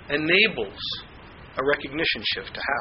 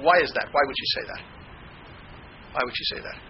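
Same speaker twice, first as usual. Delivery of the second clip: ordinary delivery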